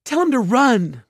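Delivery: high, loud desperate call; frantic high yell; shouting. A narrator's reading. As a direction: shout urgently for help. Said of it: "Mallory shouted".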